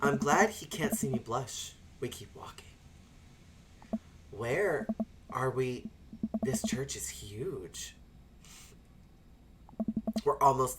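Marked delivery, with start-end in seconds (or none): 2.0-7.9 unvoiced hushed narration; whispered. Walking the dark church, hushed whisper. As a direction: whisper like sneaking.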